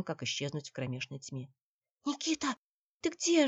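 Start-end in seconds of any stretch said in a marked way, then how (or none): 2.0-3.5 high, pleading little call; plaintive call. A narrator's reading. Наташа searches for Никита in the dark, high and plaintive. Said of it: "жалобно позвала Наташа".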